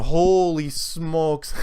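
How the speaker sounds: drawn-out, stunned exclamation; awed holy smokes. Retold or imitated. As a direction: stretch it out slowly in disbelief. Amazed reaction to fellow flat earther.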